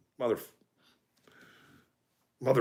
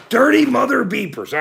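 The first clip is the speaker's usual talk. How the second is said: louder, punchier try; 7 semitones higher, 4 dB louder than usual. Shown as a demonstration. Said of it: "trying out the new insult".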